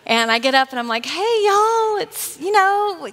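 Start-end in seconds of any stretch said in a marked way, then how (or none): 1.0-3.1 high, slow Southern American greeting; Southern drawl. Shown as a demonstration. Speaker greets the LA crowd in Southern American. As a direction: let the Southern roots out, big and high. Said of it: "talk like I like to talk".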